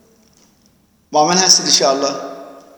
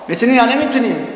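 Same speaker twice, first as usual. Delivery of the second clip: normal talking voice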